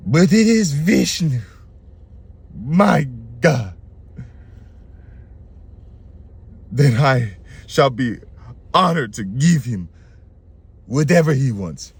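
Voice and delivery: slow, hammed-up awestruck king; solemn declaration with long, dramatic pauses; Bali. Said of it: "this is Bali".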